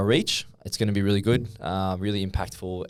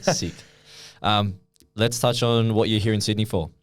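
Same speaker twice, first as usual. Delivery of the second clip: normal talking voice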